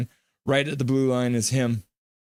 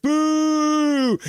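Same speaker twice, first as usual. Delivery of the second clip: much higher, squeaky-pitched boo; mock boo; 12 semitones higher, 4 dB louder than usual. Streamer voicing Gary's boo in a much higher silly voice. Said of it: "And Gary's like".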